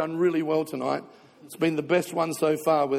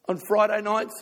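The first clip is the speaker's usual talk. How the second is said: normal talking voice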